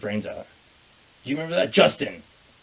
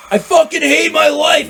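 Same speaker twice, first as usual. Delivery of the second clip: much higher, louder and slower, a mock wail of despair; retold yell; 10 dB louder, slower than usual. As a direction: bellow it like someone fed up at dawn. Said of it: "all I hear is".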